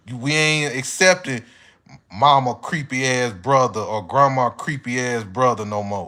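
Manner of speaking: ordinary talk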